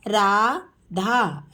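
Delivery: Radha stretched very slowly; slow teacher model of Radha. Shown as a demonstration. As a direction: draw Radha out sound by sound. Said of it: "teacher models name slowly".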